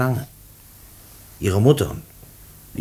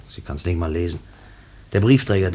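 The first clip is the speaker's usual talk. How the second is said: ordinary delivery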